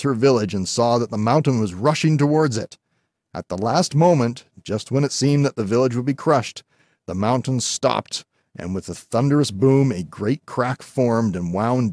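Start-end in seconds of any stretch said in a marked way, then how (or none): none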